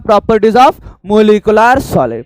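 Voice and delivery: Properties of molecular solid, each word drawn out very slowly; slow dictation. Shown as a demonstration. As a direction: spell it out slowly for the class to repeat. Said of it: "काय पाहतो बोला?".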